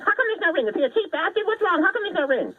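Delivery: very high falsetto squawk; hammed-up shocked girly questioning; mock outraged girlfriends. Girlfriends grilling a bride with no ring, mock outrage. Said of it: "And they would say".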